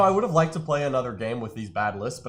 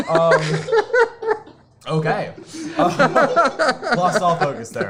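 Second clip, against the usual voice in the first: laughing; 9 semitones higher, 8 dB louder, much slower than usual. Real, not acted. Cracks up mid-interview.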